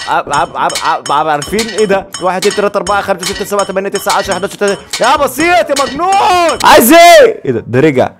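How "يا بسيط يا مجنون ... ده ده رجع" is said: much higher and louder, yelling; raised voice. Real, not acted. Gamer yells at the villain to scare him away. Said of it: "لما عليت صوتي".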